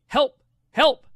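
put-on high, loud little-girl cry of help; toddler calling for help. Dad voicing his toddler daughter crying for help, high and loud. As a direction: squeal it like a stuck two-year-old. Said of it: "she keeps coming up to me and saying".

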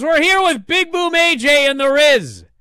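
loud, high announcer impression; Tony Schiavone. Host on a wrestling podcast imitates the ring interviewer, loud and high. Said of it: "Tony goes".

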